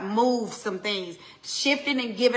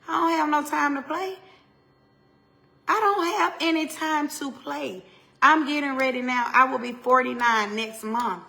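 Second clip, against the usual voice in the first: raised, loud insistence; fierce insistence; 3 semitones higher, 5 dB louder than usual. Real, not acted. Preacher to listeners, insisting loudly she has no time. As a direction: say it like you mean every word. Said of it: "insisting she has no time".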